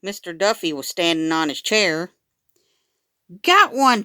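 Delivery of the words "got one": high, loud, quick cry of victory; triumphant cry. A narrator's reading. Chelsea holds up the bag with a mouse caught, crying out high and loud. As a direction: crow over the catch. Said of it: "Chelsea cried".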